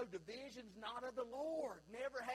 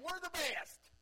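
higher, louder smug bragging; mock boast of a cliquey congregation; boastful churchgoer; 10 semitones higher, 7 dB louder, slower than usual. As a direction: crow it like a proud church member. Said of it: "They're playing".

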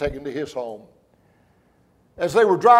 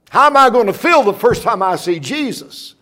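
excited outcry; 6 semitones higher, 5 dB louder than usual. Real, not acted. Preacher imagines meeting Jesus.

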